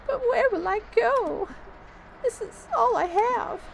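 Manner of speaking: very high, slow granny lament; acted high-pitched weepy plea; tearful old lady. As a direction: wail it slowly as a distraught old woman. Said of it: "I shouldn't be crying like this".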